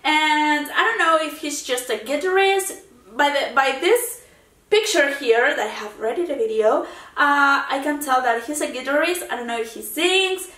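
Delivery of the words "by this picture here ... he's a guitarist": ordinary delivery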